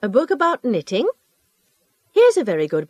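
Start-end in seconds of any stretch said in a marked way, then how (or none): 0.0-1.1 "A book about knitting?" echoed back, pitch rising to the end; rising question intonation. Acted. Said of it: "Notice how the librarian's voice rises".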